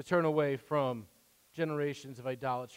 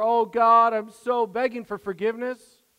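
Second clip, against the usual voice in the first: loud, higher hammed-up plea; mock begging; 7 semitones higher, 10 dB louder than usual. Mocking a believer begging God, loud and higher. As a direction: overdo the desperate pleading. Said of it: "Begging and pounding on the door".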